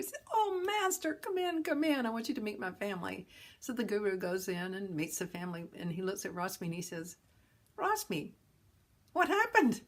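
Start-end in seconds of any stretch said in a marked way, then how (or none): 0.3-3.3 high, loud, eager greeting; overjoyed host welcoming his teacher; delighted welcome. Retold or imitated. Rashmi greets guru gladly. 7.8-9.8 high, loud dismayed question; stunned at what became of him; astonished teacher; shocked guru. Retold or imitated.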